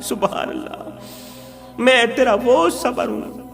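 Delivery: usual speaking voice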